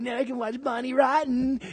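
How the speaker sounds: high-pitched, hammed-up delight; mock glee. Newly single man celebrating, mock gleeful. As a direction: gloat in a high put-on voice.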